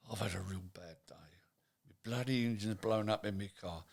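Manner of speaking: soft, whispered weary moan; tired complaint. Retold or imitated. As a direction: sigh it out like a worn-out worker. Employee voiced, bad day.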